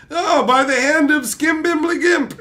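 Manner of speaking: high, loud hammed-up spell casting; over-the-top sorcerer voice; mock wizard incantation. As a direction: ham up a grand magic incantation. Mock Doctor Strange incantation.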